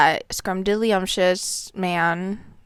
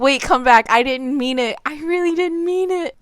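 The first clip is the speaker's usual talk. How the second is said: mock pleading; 7 semitones higher, 4 dB louder than usual. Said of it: "I was kind of like".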